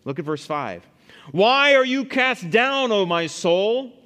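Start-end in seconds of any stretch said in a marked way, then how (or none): 1.3-3.9 loud, high, drawn-out cry to his own soul; psalmist challenging himself. Acted. Psalmist to his downcast soul, loud challenge. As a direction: question your soul in a raised, slowed voice. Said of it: "He talks to himself".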